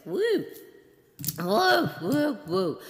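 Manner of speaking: loud, high woo bursts; excited whoops. Real, not acted. Host shaking off her ramble, loud excited whoops.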